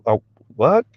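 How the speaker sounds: ordinary delivery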